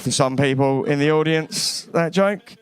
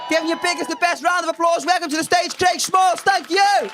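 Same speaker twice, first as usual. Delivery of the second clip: compère announcing; 12 semitones higher than usual. Acted. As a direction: whip up the crowd and bring the act on. MC announcing the next act.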